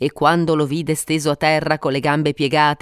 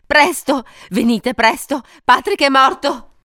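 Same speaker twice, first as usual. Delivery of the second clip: high, agitated cry for help; urgent cry; 8 semitones higher, 4 dB louder than usual. A narrator's reading. Wife phones the police for help, urgent cry. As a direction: cry out in alarm, pitched up. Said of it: "gridò".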